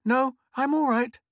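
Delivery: put-on high, loud breathless girl; gasped. Girl insisting to her mother she's fine, gasping. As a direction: puff it out, still winded. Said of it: "gasped Mink".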